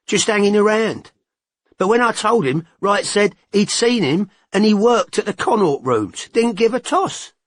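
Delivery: a gossiping informant, keen to tell; Todd's voice. A narrator's reading.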